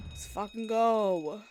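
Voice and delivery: a loud, slow, drawn-out cheer of triumph; really celebrate, stretch every word out loud; excited cheer. Real, not acted. Player cheers after success.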